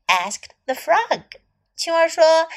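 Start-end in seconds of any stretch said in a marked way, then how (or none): none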